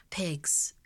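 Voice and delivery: plain reading voice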